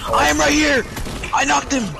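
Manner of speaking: loud, high excited yells; excited shouting. Real, not acted. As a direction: shout with hype.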